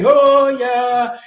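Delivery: mock rally hype call; hype crowd cry. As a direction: shout it up to rile the crowd. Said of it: "He be like".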